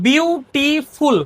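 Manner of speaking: very slow, broken-up "beautiful"; syllable by syllable. Shown as a demonstration. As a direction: say "beautiful" in separate beats, bew-ti-ful, each drawn out. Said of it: "মানে একের বেশি". ("Beautiful" শব্দটা সিলেবলে ভেঙে খুব ধীরে)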